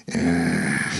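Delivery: low, soft sigh of relief; relaxed sigh. A narrator's reading. Tired man tasting sake, low relaxed sigh. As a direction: let the day slide off. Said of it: "starting to relax after a long day".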